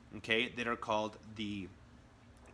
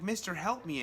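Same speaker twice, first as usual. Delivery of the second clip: higher, lightly voiced small child begging; a hint of a frightened kid asking for help; pleading little kid; 7 semitones higher than usual. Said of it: "the little kid comes up to him, he's like".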